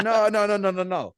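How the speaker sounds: high and loud, no no no tumbling out; urgent pleading. Retold or imitated. New hunter begging his dad to wait, urgent and high. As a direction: hold the eager hunter back, pleading hard. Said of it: "I'm like".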